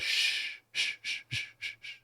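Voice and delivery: whispered shh, fading to nothing; shushing. Retold or imitated. Imitating the scammer hushing her about insider trading, whispered. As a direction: hush her like a conspirator. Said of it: "And he goes".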